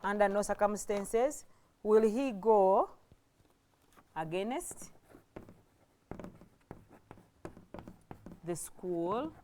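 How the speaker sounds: low, soft, piecemeal dictation; dictating while writing. Real, not acted. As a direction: say it to yourself in fragments as you write. Writing the sentence out while saying it.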